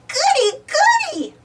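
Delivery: squealed very high with delight; thrilled little shriek; exclaiming. A narrator's reading. Baby Bop thrilled she can take many books. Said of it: "exclaims Baby Bop".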